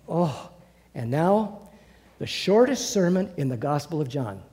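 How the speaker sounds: ordinary talk, nothing marked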